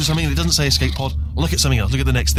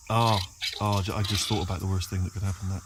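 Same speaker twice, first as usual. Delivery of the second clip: low, much softer horrified murmur; dread-filled hush; 7 semitones lower, 9 dB quieter than usual. Real, not acted. Robot imagines the worst outcome, hushed dread. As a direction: sink into sudden dread.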